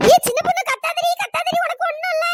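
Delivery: high, loud pleading; urgent calming shout. Acted. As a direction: shout to calm a panicking friend. Urgently calming a screaming friend.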